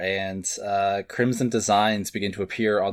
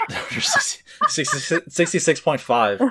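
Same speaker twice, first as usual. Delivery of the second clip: laughing shout; 7 semitones higher than usual. Pretend. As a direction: blurt it out while cracking up. Joking order callout, laughing.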